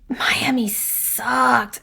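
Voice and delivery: slow whispered aside; hushed, unvoiced grumble. Real, not acted. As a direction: drop to a secret whisper to slag it off. Bitter aside about the trip.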